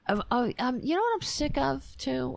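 ordinary talk, nothing marked

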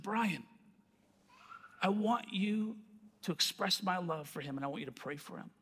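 hushed, unhurried voice in the heart; gentle inner voice. Retold or imitated. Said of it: "I heard that sweet gospel coming".

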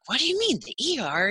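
high, loud disbelieving yelp; husband taken aback that his wife wants the ER, high and startled; startled question. Retold or imitated. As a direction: jump up in pitch, shocked.

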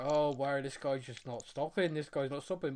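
retold hushed, uneasy disbelief; awkward aside. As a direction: say it low, as if to yourself. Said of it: "I'd feel really awkward".

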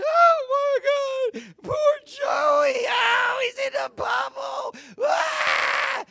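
high, loud hammed-up wailing; mock bawling. Imitating mom and sister at a tearjerker, hammed-up bawling. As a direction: wail in exaggerated grief, mimicking weepy TV watchers. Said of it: "they would just be bawling, bawling their eyes out".

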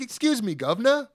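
high, hammed-up British "governor"; mock British accent. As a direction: tease in a fake British accent. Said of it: "Yeah, British accent".

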